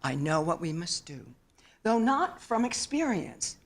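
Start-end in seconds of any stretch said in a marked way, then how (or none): none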